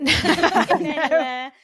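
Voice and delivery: laughing. Real, not acted. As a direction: stretch it out slowly through laughter. Laughing emphatic punchline.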